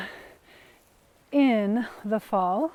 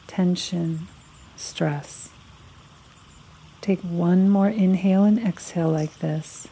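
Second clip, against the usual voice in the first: guided meditation; 4 semitones lower than usual. Acted. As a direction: lead them unhurriedly through a body scan, phrase by phrase. Leads a guided body-scan meditation.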